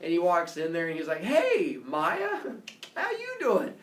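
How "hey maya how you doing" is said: high, louder jovial greeting; a high, hammy friendly hello; high mock Zeus greeting.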